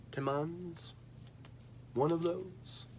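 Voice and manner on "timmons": Timmons with a full "ah" in the last syllable, softly; tentative pronunciation. Shown as a demonstration. Reviewer guessing at an Italian name, hushed tih-MAHNZ for Timmons. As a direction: try a quiet, questioning tih-MAHNZ for Timmons. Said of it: "trying to think how I pronounce".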